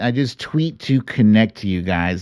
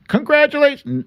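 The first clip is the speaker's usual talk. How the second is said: high, sing-song mock announcement; imagined app cheering you, high and sing-song; mock sing-song congratulations; faster than usual. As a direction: trill it like a cheesy pop-up message. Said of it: "a notification from Twitter saying".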